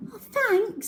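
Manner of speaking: relieved thanks; girl relieved to be found; Cherry relieved. A narrator's reading. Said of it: "said Cherry".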